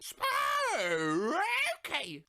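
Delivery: high, shouted creepy toy voice; spooky toy voice. Retold or imitated. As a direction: squeal it like a haunted toy. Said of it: "it went".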